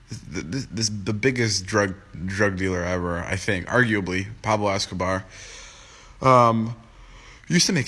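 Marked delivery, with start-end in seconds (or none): none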